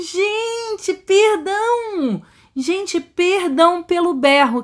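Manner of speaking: high, drawn-out apology after a fright; shaken apology. Real, not acted. Gamer apologizing to viewers for screaming, shaken. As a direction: apologize slowly, still rattled by the scare.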